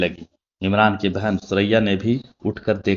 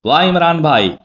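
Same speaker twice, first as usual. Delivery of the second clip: much higher and louder eager hail; excited greeting; 9 semitones higher, 7 dB louder than usual. A narrator's reading. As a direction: call out to him gladly, high and loud. Girl greets Imran eagerly. (खुशी से ऊँची आवाज़ में पुकारना)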